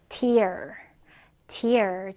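"tear" said like "tier", with an ee sound; noun pronunciation. Shown as a demonstration. Teacher shows students the noun, "tear" pronounced like "tier". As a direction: say "tear" to rhyme with "ear". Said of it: "tear 명사로 눈물이란 뜻이요".

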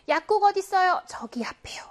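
hushed whispered dialogue. Acted. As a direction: whisper it like asking in secret. Acted example dialogue, whispered.

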